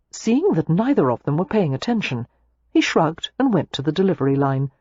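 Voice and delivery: plain reading voice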